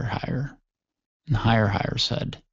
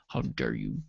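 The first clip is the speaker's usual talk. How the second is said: very low, soft, half-asleep grumble; sleepy Nothing; 14 semitones lower, 10 dB quieter than usual. Acted. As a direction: protest as if barely awake. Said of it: "I started to fall asleep again".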